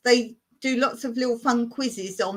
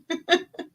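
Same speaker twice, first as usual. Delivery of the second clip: giggle; 7 semitones higher than usual. Real, not acted.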